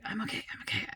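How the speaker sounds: barely-there whisper to yourself; whispered self-soothing. Retold or imitated. Mother telling herself she's okay as adrenaline rushes, whispered. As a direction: whisper it as a retold self-reassurance.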